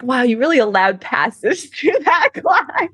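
mock-impressed praise dissolving into laughter; cracking up. Joke quote, cracks up midway.

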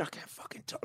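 barely-there voiceless rasp; a lost voice pushing out only air; strained voiceless whisper. Shown as a demonstration. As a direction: whisper it with no voice left, like the cords won't work. Showing his lost voice.

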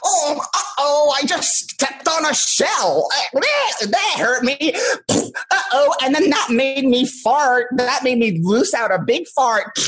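very high, slow, silly whine; hammed-up, drawn-out squeaky complaining; mock childlike God. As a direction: squeal it like a hapless cartoon child. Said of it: "he's not going around going".

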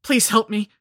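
high, loud cry for help; panicked plea. A narrator's reading. Dudley stretched on the altar, crying for help in panic. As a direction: beg urgently at the top of your fear. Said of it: "Dudley's eyes rolled with panic".

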